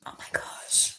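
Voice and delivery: breathless whisper through tears; real whisper, holding back crying; tearful whisper. Real, not acted. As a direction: whisper it to yourself, choking up. Fighting tears, whispered aside.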